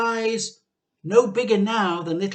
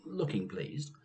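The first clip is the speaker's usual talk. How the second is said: ordinary delivery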